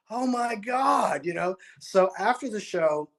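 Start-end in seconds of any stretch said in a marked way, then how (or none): 0.1-1.2 high, slow, stretched-out oh my God; amazed exclamation. Retold or imitated. Telling of his reaction after the show, slow amazed oh my God. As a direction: gasp it out in amazement. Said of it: "I'm like".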